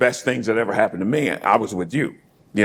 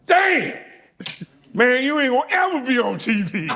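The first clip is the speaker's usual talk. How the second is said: high-pitched mock yell, laughing; retold holler pitched way up; brother's yell; 13 semitones higher than usual. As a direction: imitate an excited yell from the background. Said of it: "my brother yells out in the background".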